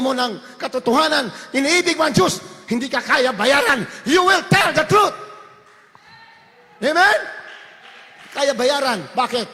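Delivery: loud, high shouted preaching; fiery preaching. Acted. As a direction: thunder the truth at the congregation. Preaching at full force. (malakas na pangangaral)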